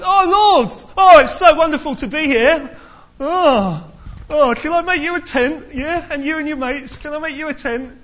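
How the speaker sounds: high, loud, overeager mock gushing; gushing Peter. Preacher mocking Peter's eager offer, gushing and high. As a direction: ham up Peter fawning over Jesus.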